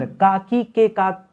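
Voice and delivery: काकी के का drawn out very slowly, each syllable spaced; slow mnemonic recital. Shown as a demonstration. Grammar teacher reciting the काकी के का rule to students, very slow. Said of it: "काकी के जो पढ़ते हैं ना".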